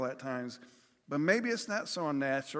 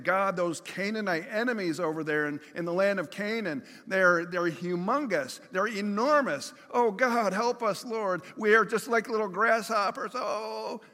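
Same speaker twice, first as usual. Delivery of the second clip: rising, louder cry of fear to God, higher and higher; panicked plea; 4 semitones higher, 3 dB louder than usual. Retold or imitated. Preacher voicing frightened Israelites begging God for help. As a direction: plead like a terrified small people before giants. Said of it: "the Israelites saying".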